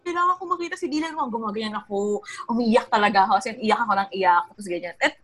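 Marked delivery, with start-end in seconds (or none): 0.0-1.3 higher, whiny demanding tone; demanding girl. Retold or imitated. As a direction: demand it like a spoiled girlfriend. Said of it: "parang akong demanding girl". (parang demanding na batang babae)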